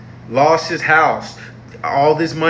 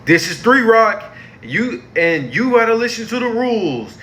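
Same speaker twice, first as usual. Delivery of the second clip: mock Eve; 10 semitones higher than usual. Mocking Eve laying down Three Rock rules, high mock-stern voice. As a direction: ham up a strict warden who's not convincing. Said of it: "Eve is like".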